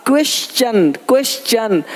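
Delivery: क्वेश्चन with sh then ch, "kwesh-chan"; correct pronunciation. Shown as a demonstration. Teacher modelling question for learners, correct pronunciation. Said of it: "टी का उच्चारण चा होता है".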